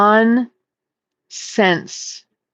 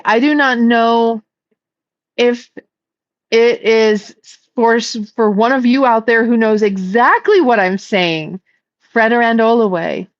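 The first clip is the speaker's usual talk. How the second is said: ordinary talk, nothing marked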